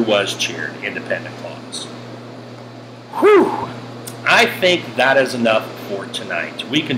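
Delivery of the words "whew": high-pitched blown-out whew; relieved whew. Real, not acted. Teacher finishing a tough grammar example, relieved whew. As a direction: let out a short, high whew. Exhales after a hard sentence.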